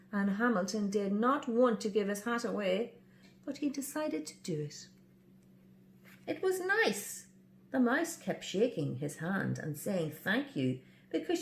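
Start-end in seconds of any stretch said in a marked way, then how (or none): none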